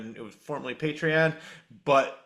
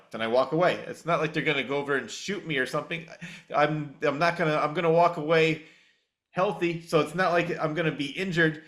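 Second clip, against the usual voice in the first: normal talking voice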